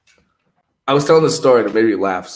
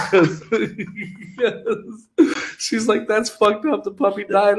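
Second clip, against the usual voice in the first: laughing; 11 semitones higher than usual. Real, not acted. Laughing while quoting girlfriend.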